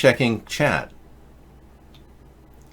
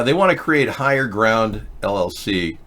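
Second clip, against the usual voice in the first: ordinary delivery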